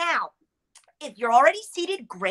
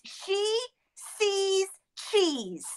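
slow tongue twister; 5 semitones higher, 6 dB quieter, much slower than usual. Shown as a demonstration. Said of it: "This is a really short one, so I'll be able to say it".

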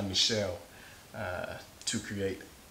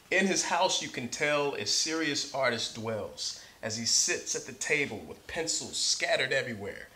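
bright, lively read-aloud, a bit higher and louder; story narration; 4 semitones higher, 4 dB louder than usual. Acted. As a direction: read it like to a class of kids. Reads picture book aloud.

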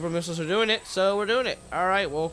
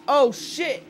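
high, loud startled curse; startled shout; 8 semitones higher, 6 dB louder than usual. Real, not acted. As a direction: blurt it out in sudden fright. Sudden scare in game.

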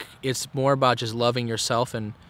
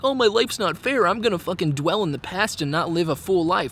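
high, whiny mocking voice; mock whiny complainer; 6 semitones higher than usual. Mocking people who sulk that life isn't fair: high whiny mock voice. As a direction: mimic a self-pitying sulker. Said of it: "I'm not pouting and bitching like".

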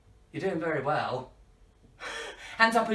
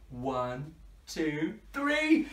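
ordinary delivery

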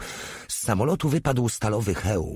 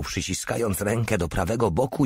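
usual speaking voice

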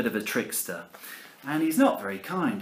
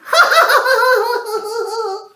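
high, loud teasing cackle; mocking child laugh; 12 dB louder than usual. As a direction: laugh at grandpa. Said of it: "Alfie laughs at his grandpa".

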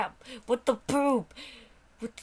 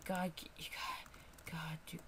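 whispering; 5 semitones lower, 15 dB quieter, slower than usual. Real, not acted. Frustrated at game, muttering.

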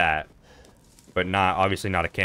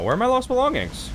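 much higher, squeaky mock-panicked question; mock dismay. Player hamming up dismay over lost belongings, high mock panic. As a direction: wail it in a put-on high voice.

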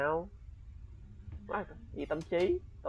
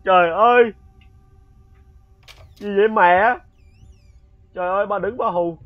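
loud startled cry after a jump scare; real fright; 7 semitones higher, 10 dB louder than usual. Real, not acted. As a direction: yelp it out, genuinely spooked. Jump scare from the grandmother ghost.

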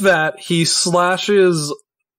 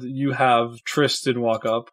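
normal talking voice

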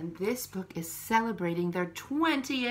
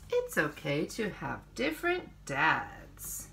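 put-on reading to children; 4 semitones lower, 3 dB louder than usual. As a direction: read each page to little ones, unhurried. Reads picture book to children.

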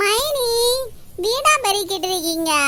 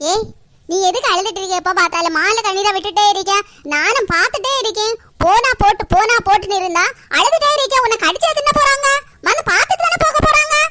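angry scolding; 7 dB louder than usual. Acted. Aunt scolding the crying girl.

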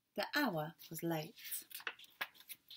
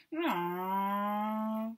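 high, louder droning neeow; a child playing aeroplane, droning away; aeroplane noise; 4 semitones higher, 5 dB louder than usual. A narrator's reading. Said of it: "went Baby Bear".

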